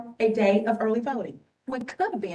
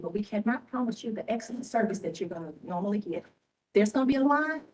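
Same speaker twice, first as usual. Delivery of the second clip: ordinary delivery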